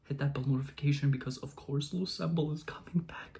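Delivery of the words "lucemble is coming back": hushed thrilled whisper. Real, not acted. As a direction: whisper it like a secret. Excited whispered aside.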